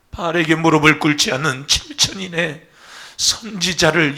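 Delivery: loud, fervent pastoral prayer; public prayer. Real, not acted. Pastor praying over the congregation, fervent prayer. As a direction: pray over the people with fervor.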